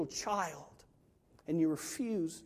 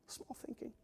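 barely voiced, very soft aside; hushed repeat; 5 semitones higher, 20 dB quieter than usual. Real, not acted. Preacher repeating his verdict to the congregation, hushed. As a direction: let it sink to a near whisper.

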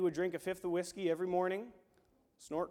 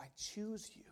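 whispered; 2 semitones higher, 14 dB quieter than usual. Acted. God speaking tenderly.

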